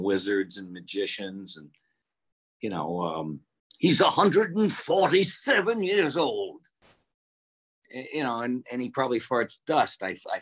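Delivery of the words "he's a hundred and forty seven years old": put-on doddering old professor. Voicing the ancient professor.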